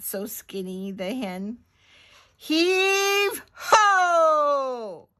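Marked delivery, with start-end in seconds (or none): 2.3-5.1 high, loud, long-drawn "heave ho"; big straining launch cry; heaving call. Acted. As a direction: sing it out like a work crew. Launching the barrel.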